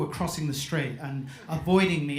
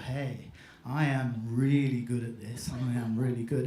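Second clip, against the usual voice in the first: smug self-congratulation; 6 semitones lower, 4 dB quieter than usual. Retold or imitated. Hypnotist thinking his trance has worked, smug self-congratulation.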